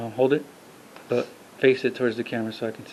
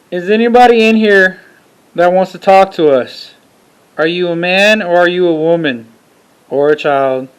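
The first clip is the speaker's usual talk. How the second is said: loud call into the dark room; talking to a ghost; 3 semitones higher, 12 dB louder than usual. Real, not acted. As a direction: call out to an unseen spirit. Calling out in an EVP session.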